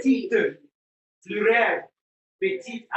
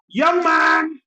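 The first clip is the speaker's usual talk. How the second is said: sharp high loud call; 10 semitones higher, 7 dB louder than usual. Real, not acted. Teacher calling boy sharply.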